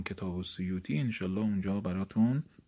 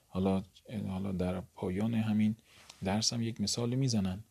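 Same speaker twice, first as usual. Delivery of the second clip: normal talking voice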